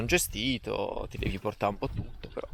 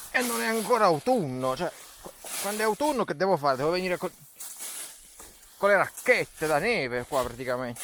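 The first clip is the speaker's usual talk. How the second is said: exasperated; 7 semitones higher than usual. Real, not acted. Mock complaint about fallen leaves.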